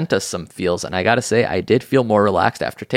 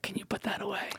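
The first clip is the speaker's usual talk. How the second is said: hushed unvoiced whisper; 12 semitones higher, 16 dB quieter, faster than usual. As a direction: whisper it to a stranger in the cinema. Shushing a phone user.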